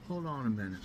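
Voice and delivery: usual speaking voice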